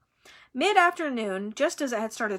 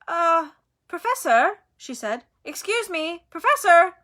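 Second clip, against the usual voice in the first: put-on higher girl's voice, louder each call; calling to wake someone; 7 semitones higher, 7 dB louder than usual. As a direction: try to wake a sleeping teacher, politely then firmly. Hermione trying to wake Lupin.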